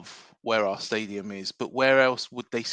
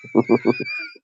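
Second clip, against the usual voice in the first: laughing at himself; 8 dB louder than usual. Real, not acted. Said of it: "That was terrible".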